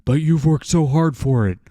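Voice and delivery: mock falsetto read of a comic line; mocking put-on voice. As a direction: ham up a mocking caricature voice. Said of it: "that's my transgender voice now".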